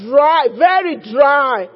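loud fervent declaring prayer; preaching prayer. Acted. Preaching prayer at full force.

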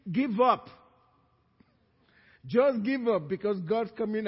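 loud, fervent pulpit preaching; preaching at full force. Acted. Pastor warning runaway children God is coming after them, fervent preaching. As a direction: thunder it over the wayward young.

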